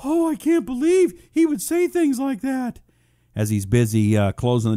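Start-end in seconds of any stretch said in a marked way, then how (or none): 0.0-2.8 mock-shocked falsetto, loud and high; hammed-up pearl-clutching outrage; Matt Lauer. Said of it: "like, uh, Matt Lauer".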